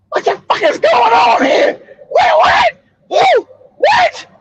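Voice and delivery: very high, shouted short questions; stunned outrage. Retold or imitated. Grieving father at the press conference, shouting high in shock. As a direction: hit each word in shocked disbelief.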